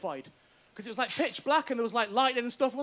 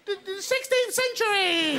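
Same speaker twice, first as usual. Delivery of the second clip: slow, pitched-up mimicry; mimicking her; 6 semitones higher, slower than usual. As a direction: drag out the line mocking the actress. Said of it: "little face going".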